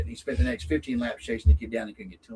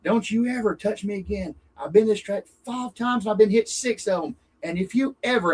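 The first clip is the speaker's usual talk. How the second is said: higher, hammed-up warning; mock furious threat; 6 semitones higher than usual. Driver warning the kid who hooked him, mock furious. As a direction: play the angry driver squaring up.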